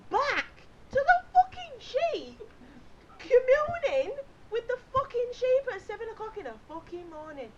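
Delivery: loud, high, dragged-out outrage; livid. Retold or imitated. Ranting about man bleating at sheep, livid. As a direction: explode in comic disbelief. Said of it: "I were fucking livid".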